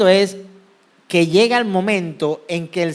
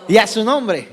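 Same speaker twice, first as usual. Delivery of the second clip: playful call-and-response shout, lightly done; charismatic preacher. Pretend. Preacher prompts congregation's response, mock charismatic call. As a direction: prompt the crowd with a chuckle. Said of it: "como los carismáticos".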